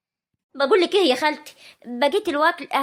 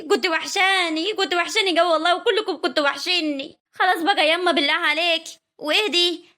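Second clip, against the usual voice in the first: normal talking voice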